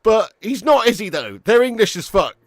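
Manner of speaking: loud, high, exasperated; heated rebuttal. Real, not acted. Streamer answering a viewer's grandparent point, loud and heated. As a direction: concede briefly, then push back loudly.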